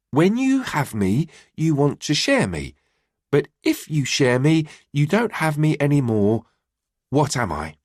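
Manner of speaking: put-on high child voice, careful and measured; careful recital. A narrator's reading. Boy repeating the riddle to his mum, careful recital. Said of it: "with the same level of concentration as the first time".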